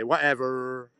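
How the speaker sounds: a hint of an American accent on "Whatever". Shown as a demonstration. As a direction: put a light American twang on "Whatever". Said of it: "and you say".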